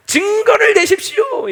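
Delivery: very high, loud, slow squeal; shrill childish demand for proof; mock demand. Israelites demanding proof, very high and loud. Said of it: "이러면서 따지는 거예요".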